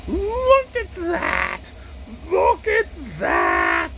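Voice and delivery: very high, drawn-out, loud exclamation; slow, straining shout of mock wonder; hammed-up awe. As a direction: stretch each word in over-the-top amazement. Repeats admiration of beer, hammed up.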